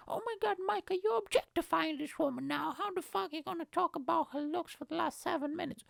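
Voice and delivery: shrill mock outrage at Mike; high, scolding imagined listener.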